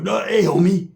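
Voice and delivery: a light put-on street voice, begging; Joey Stacks impression. Imitating the two talking, lightly put-on homie voice. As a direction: mimic a stammering homie. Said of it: "it's like hearing Joey Stacks like".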